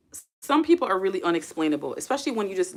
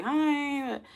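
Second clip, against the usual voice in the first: high, drawn-out "hi"; mock polite hello, pitched way up; strained polite greeting; 9 semitones higher than usual. Streamer mimics awkward hello with ex's family, high-pitched polite greeting.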